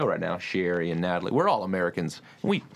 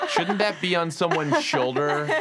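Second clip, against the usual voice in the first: higher, slow, laughing it out; laughing joke; 9 semitones higher, 3 dB louder, slower than usual. Pretend. Cohost joking to guest, slow laughing quip. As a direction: tease about the boombox.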